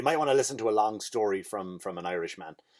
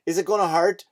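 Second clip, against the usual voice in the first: higher, rising anxious question; nearly crying child; 6 semitones higher, 4 dB louder than usual. Retold or imitated. Son walks in to give blood, imitated as nearly crying. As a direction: imitate a nervous little boy. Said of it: "Little fella crying nearly".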